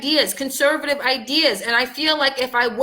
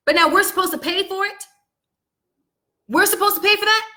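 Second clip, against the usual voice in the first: incredulous outrage; 6 semitones higher, faster than usual. Real, not acted. Outraged at paying for services.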